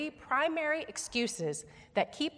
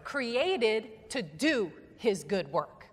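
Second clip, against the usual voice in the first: slow, stress on do; "Created to do his good work" slow, DO stressed; emphatic call line; much slower than usual. Shown as a demonstration. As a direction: lead the congregation with weight. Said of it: "say it with emphasis and like you mean it".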